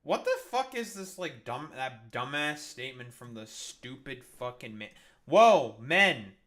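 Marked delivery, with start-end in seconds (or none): 5.2-6.3 loud scolding yell; outraged shout. Real, not acted. Streamer yells at the men on the news, outraged shout. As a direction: bellow it at the screen.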